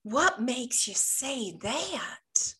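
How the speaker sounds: very slow Deep South drawl, stretched vowels; Southern drawl. Retold or imitated. Real estate trainer from the deep South teaches her question, Southern drawl. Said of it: "this lady had this deep drawl".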